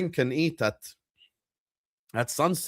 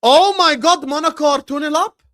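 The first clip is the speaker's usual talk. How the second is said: much higher, loud disbelief; excited shout; 12 semitones higher, 10 dB louder than usual. Real, not acted. Streamer shouting in disbelief at the Monaco score.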